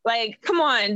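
ordinary talk

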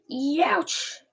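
disgusted giant's outcry; roar of disgust. A narrator's reading. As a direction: cry out at a foul taste. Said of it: "roared the blood bottler".

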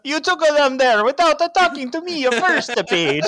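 very high, loud mock-Italian rant; hammed-up angry Italian; horrible Italian accent. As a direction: rage in a badly faked Italian accent. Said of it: "It's my horrible Italian".

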